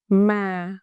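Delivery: "Ma" drawn out, sinking in pitch; falling tone. Shown as a demonstration. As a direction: let "Ma" glide gently down. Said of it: "Pitch go down".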